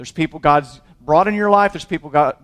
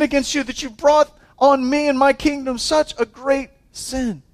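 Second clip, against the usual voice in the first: higher, exasperated disbelief; incredulous rebuke; 8 semitones higher than usual. Retold or imitated. The king's men rebuking Abraham in disbelief. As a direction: throw it back at him, are you kidding me. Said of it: "they're like".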